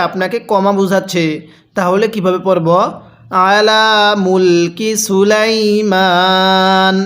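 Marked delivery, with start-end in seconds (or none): 3.5-6.9 slow chanted সুলাইমান, closing on a bare "n"; pausal ending. Shown as a demonstration. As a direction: stretch সুলাইমান and drop the final vowel. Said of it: "আমি এই জায়গার মধ্যে ওয়াকফ করছি". (টেনে সুলাইমান, নুনের জবর বাদ দিয়ে থামা)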